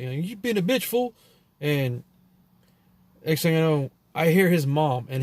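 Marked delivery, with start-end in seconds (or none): 0.1-1.1 put-on higher, sneering punk-kid voice; cocky kid's voice. Boy mouthing off about his mom, lightly voiced sneer. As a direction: toss it off like a cocky teen. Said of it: "he literally talked like that".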